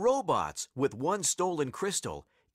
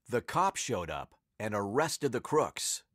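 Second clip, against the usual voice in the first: usual speaking voice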